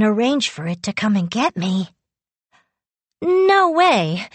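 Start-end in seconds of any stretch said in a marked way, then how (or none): 3.2-4.3 high, alarmed refusal; dismayed protest. A narrator's reading. Girl refusing to lose Yu, dismayed protest. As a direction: protest it, pitch leaping up in dismay. I felt like crying.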